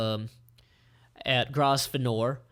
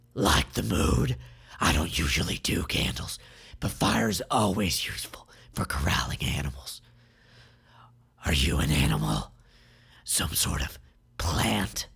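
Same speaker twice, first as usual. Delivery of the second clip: deep, hushed villain voice, half whispered; a low creepy captor sizing up his prey; Melik menacing; 8 semitones lower than usual. Acted. Melik murmurs to captive Sai in a deep creepy voice.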